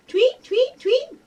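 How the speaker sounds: very high rising tweets; bird tweeting. Acted. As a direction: chirp it like a tiny bird. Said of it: "What kind of bird sounds".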